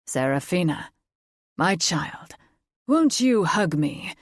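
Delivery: a lightly voiced fae father, pleading with an edge; edgy pleading father. A narrator's reading. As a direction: coax her with a thin sharp edge under it. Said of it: "There was an edge to the man's plea".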